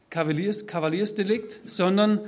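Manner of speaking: ordinary talk, nothing marked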